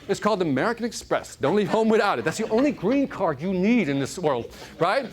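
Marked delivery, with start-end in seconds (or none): none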